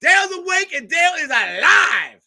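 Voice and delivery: high, loud mock announcement; excited shout. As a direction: yell it like a hype man waking the crowd. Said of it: "Craig's like".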